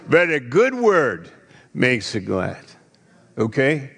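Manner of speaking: usual speaking voice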